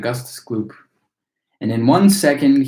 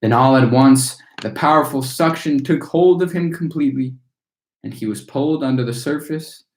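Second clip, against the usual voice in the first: plain reading voice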